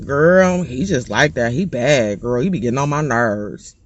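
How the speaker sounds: low, soft lazy shrug of a voice; unbothered mom. Retold or imitated. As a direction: brush it off like nothing's wrong. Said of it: "she's sitting there like".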